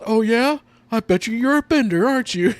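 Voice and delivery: high, loud challenge; mock accusing. Retelling the protester challenging her, mock accusing. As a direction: imitate a heckling accuser, pitched well up. Acted quarrel from the show.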